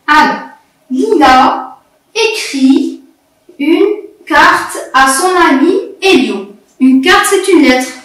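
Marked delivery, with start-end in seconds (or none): 0.9-6.5 measured teacher read-aloud. Shown as a demonstration. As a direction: read word group by word group, with pauses. Teacher models reading a short text.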